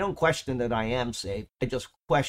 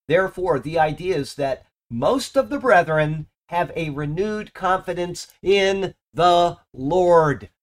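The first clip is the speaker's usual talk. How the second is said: raised, emphatic sermon voice; preaching; 4 semitones higher, 4 dB louder than usual. Acted. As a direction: preach it with conviction to the congregation. Preaching from the pulpit.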